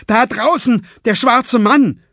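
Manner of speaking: loud, high-pitched shout of alarm; alarmed cry. A narrator's reading. Fletcher spots a man at the window, crying out. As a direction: cry out as if you've just spotted danger. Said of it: "stieß Fletcher einen Schrei aus".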